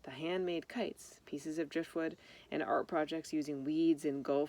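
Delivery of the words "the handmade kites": usual speaking voice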